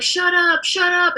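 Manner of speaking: high, drawn-up mock sleep outburst; imitated sleeping dad. Dad talking in a nightmare, high imitated outburst. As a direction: act out dad protesting in his sleep. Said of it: "so he would be like".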